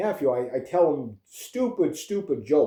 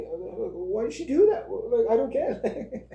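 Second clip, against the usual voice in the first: put-on puzzled kid; 8 semitones higher, slower than usual. Nine-year-old nephew, mortified, quoted.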